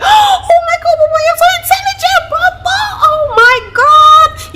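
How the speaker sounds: very high, loud, gushing praise; excited praise. Retold or imitated. Mother cheering her son for seventh place, very high and loud. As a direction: heap joyful praise on Bobo. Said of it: "I was like".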